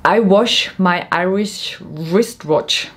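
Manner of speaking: careful, slow articulation; carefully slow tongue twister. Shown as a demonstration. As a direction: spell out each word of the twister slowly. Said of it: "Try to do a lot of tongue twisters".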